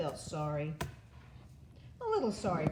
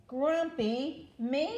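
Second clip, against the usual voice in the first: indignant surprise; 8 semitones higher, slower than usual. A narrator's reading. Jim indignant again.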